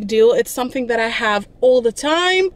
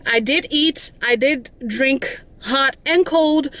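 usual speaking voice

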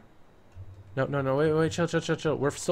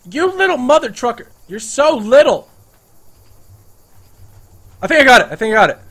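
much higher, louder excited yells; excited yelling; 9 semitones higher, 12 dB louder than usual. Real, not acted. Chasing a lizard in the game, excited loud yelling. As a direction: shout as the kill lands.

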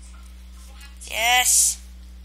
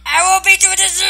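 excited yell; 5 semitones higher, 8 dB louder than usual. Real, not acted. Yelling excitedly in game.

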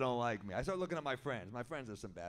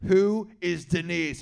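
accusing; 3 semitones higher, 9 dB louder, slower than usual. Retold or imitated. Girlfriend finds a napkin.

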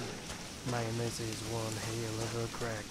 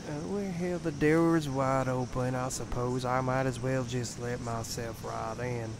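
normal talking voice

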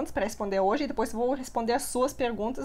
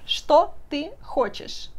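slow clear demo; 5 semitones higher, 4 dB louder, much slower than usual. Shown as a demonstration. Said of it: "O que você quer?".

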